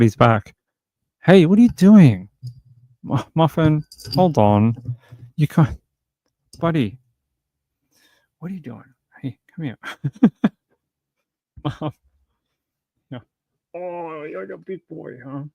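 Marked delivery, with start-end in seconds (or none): 1.2-7.0 high, playful voice for the cat; pet talk. Real, not acted. Talking to the cat on stream, high and playful. 8.4-15.4 soft cooing to cat. Real, not acted. Owner cooing softly to the cat, much softer.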